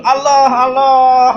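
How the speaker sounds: high, loud cry of Allah; panicked mock cry. People screaming in earthquake.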